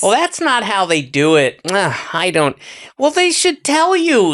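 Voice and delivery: high, whiny mock complaint; complaining American tourist. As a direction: mimic a fussy American tourist griping abroad. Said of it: "it's a complaint".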